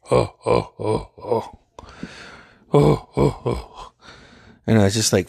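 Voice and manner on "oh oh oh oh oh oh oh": hammed-up, halting "oh, oh" laughter; overdramatic laugh. Friend announcing his journey after a vision, mock overdramatic laughing. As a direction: imitate a friend's theatrical fake-sounding laugh. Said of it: "he's laughing over dramatically".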